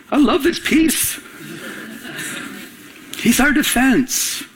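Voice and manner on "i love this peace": high, slow, drawn-out delight; relishing. Acted. Pastor delighting in God-given peace, relishing. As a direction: savor each word up high.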